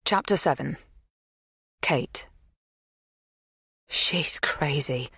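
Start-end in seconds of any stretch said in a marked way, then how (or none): 3.9-5.1 slow breathless whisper; whispered thought. A narrator's reading. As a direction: whisper it slowly to yourself. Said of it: "I think".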